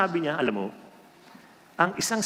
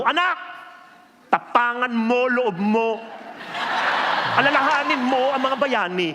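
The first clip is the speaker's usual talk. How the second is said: mock stern mother; 7 semitones higher than usual. Mother telling her crying child to be brave, hammed-up sternness. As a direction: urge the crying child to toughen up.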